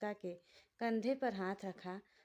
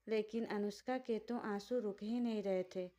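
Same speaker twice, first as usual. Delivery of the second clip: ordinary talk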